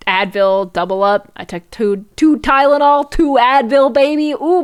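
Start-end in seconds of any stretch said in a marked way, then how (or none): 2.2-4.6 hyped brag. Real, not acted. Bragging about doubling up.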